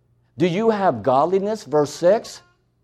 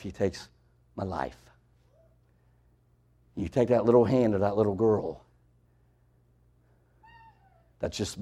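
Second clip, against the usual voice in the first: hushed sermon; 12 semitones lower, 9 dB quieter than usual. Acted. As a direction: pull the congregation in close and speak gently. Hushed intimate preaching passage.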